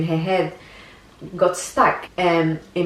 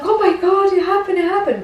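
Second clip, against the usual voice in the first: high, excited gasp of disbelief; breathless high-pitched joy, reliving the moment; overjoyed disbelief; 13 semitones higher, 4 dB louder than usual. Retold or imitated. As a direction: squeal it out like the baby just arrived. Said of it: "I was like".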